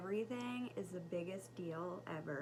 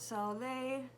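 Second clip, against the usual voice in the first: ordinary talk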